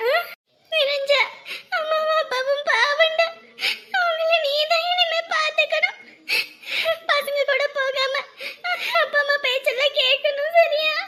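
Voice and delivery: high, sobbing farewell instructions; slow, tearful pleading with gasps between phrases; crying. Acted. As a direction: entrust them through sobs. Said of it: "இப்ப எதுக்கு அழுதுட்டு இருக்க".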